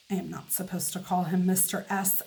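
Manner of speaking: ordinary delivery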